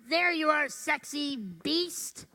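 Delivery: wink at yourself in the mirror; mock flirty swagger. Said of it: "go to the mirror and go".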